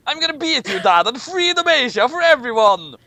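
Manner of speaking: high, loud battle cry at his father; defiant shout. Acted. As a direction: yell it as a challenge to Dad. Son defies emperor father in story.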